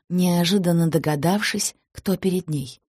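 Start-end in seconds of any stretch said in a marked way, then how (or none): none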